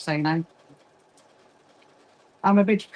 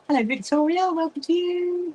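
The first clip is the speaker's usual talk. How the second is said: a high, lilting hello to a viewer; sing-song greeting; 9 semitones higher than usual. Real, not acted. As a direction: greet her brightly, voice up high. Greeting a viewer on a live stream.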